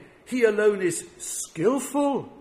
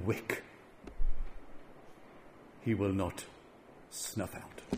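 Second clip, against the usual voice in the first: tender scripture reading; 7 semitones lower, slower than usual. Acted.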